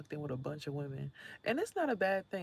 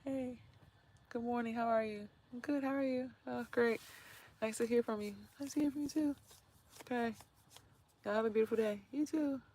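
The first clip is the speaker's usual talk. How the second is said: polite neighbors; 7 semitones higher than usual. Pretend. Acting out quiet polite greetings.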